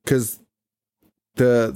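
usual speaking voice

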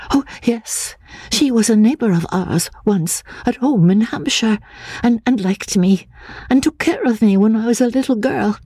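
put-on high, timid voice of a fearful girl; frightened young woman. A narrator's reading. Anne, fearing the asylum, asks the narrator in a high timid voice.

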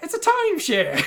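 high, bright NPC voice, amused; joking character voice. Acted. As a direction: play the villager laughing at his own joke.

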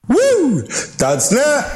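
high-pitched hype-man whoop; playful cheering. Pretend. Co-host cheering the website plug, high playful whoop. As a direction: whoop it up like a rowdy fan.

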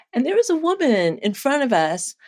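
ordinary delivery

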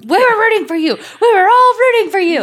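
Tyra Banks impression. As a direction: plead like a tearful reality-show host, way up high. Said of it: "Yes, Tyra Banks".

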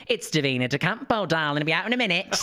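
high, put-on drag queen call; Davina De Campo. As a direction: call out grandly as a mock diva. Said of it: "decided to mess with Davina and went".

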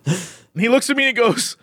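ordinary talk, nothing marked

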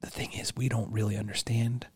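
hushed, breathless whisper; worried whisper. Real, not acted. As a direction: whisper it like a guilty secret. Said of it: "Why are we whispering?".

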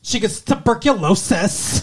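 high, bright Valley girl imitation; mock her like a Valley girl. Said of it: "Sound like a Valley girl when you said that".

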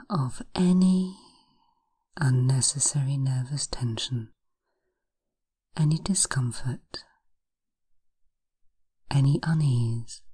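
slow, hushed hypnosis voice; breathy guided relaxation, unhurried. Acted. Hypnosis session guiding relaxation.

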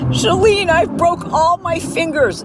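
panicked neighbor. Retold or imitated. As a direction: imitate a hysterical neighbor on voicemail. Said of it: "I get this crazy voicemail message from her".

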